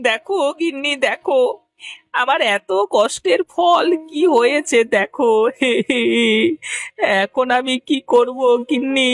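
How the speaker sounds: high, sobbing wail to the wife; hammed-up weeping; crying. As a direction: bawl helplessly, dragging out the endings. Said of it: "এভাবে কাঁদছো কেন?".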